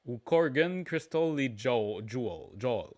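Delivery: surnames switched to English pronunciation; English accent. Shown as a demonstration. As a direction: say the names as an English speaker would. Same names, now in English pronunciation.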